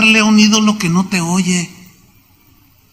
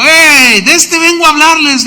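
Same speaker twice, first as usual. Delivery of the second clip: higher, louder excited announcement; eager call; 8 semitones higher, 4 dB louder than usual. Acted. Paul calling to the Greeks, eager and louder. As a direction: call out to the crowd with discovery.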